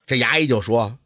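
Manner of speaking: plain reading voice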